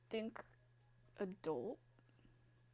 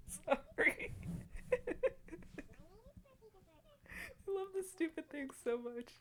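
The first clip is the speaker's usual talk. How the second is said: real fit of laughter, squeaking through words; can't stop laughing at the silly voice; high giggly laughing apology; 12 semitones higher than usual. Real, not acted. Helpless laughter at game voice.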